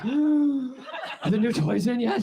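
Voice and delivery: high, slow, breathless gasping question; breathy caller. Retold or imitated. A breathless caller phoning McDonald's about new toys, breathy. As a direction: gasp it out like a creepy eager caller. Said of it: "the breathiest phone call of all time".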